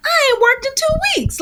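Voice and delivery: high whiny complaint, imitated; complaining co-star. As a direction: mimic a grumbling actor in a high voice. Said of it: "the guy playing the dad was like".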